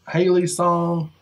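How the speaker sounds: ordinary delivery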